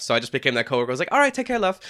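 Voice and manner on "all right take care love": high, bright British-style farewell with "love"; mock British "take care, love". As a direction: sign off like a British coworker. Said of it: "I've just picked up love".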